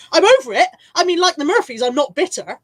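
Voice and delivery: high, laughing insistence; laughing protest. Real, not acted. Insisting to listeners she's over the old club snub, laughing. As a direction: protest through laughter, pitch jumping up.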